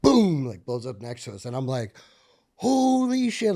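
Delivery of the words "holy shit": high, loud stunned curse; shocked exclamation. Retold or imitated. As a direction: react to the blast in shock. RPG explodes next to them.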